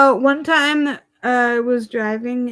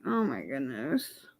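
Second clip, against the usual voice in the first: hushed mock dismay; 10 semitones lower, 12 dB quieter, slower than usual. Mock shocked reaction to guard.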